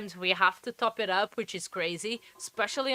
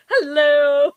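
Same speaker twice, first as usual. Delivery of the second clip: loud, higher-pitched hail; calling hello; 4 semitones higher, 13 dB louder than usual. Real, not acted. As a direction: holler a greeting to the cameraman. Calls to the cameraman.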